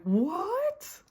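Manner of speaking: squeaky, outraged high yelp; shocked what. Retold or imitated. The workhouse man's mock outraged yelp, high and loud. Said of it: "And the guy's like".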